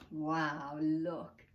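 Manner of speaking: usual speaking voice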